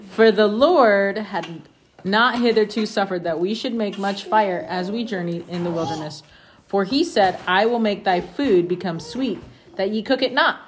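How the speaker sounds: steady, measured reading of scripture. Acted. Parent reading scripture to the family, measured and steady. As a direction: read it aloud to the family like scripture.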